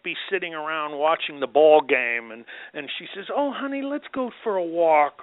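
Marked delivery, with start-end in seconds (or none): 3.3-5.2 higher-pitched coaxing wife; sweet wife impersonation. Retold or imitated. Wife asking husband out for a walk, coaxing impersonation. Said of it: "That was a great impersonation".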